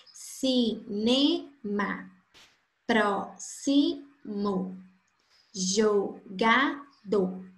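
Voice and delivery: slow, each syllable pulled apart. Shown as a demonstration. Teacher counting syllables for the class, very slow. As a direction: spell out every syllable for the class. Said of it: "possuem três sílabas".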